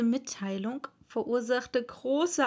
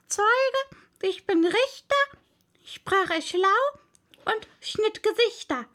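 mouse telling its tale; 10 semitones higher, 4 dB louder than usual. A narrator's reading. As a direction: squeak out the tale like a small mouse. The mouse's tale, high throughout.

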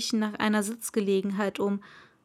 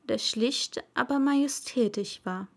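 usual speaking voice

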